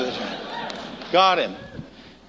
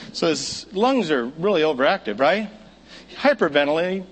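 usual speaking voice

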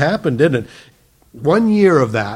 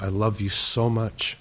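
soft, low, tender appeal; loving concern; 3 semitones lower, 4 dB quieter than usual. Retold or imitated. Preacher confronting his brother about salvation, lovingly and softly. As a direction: gently plead out of love, keeping it quiet.

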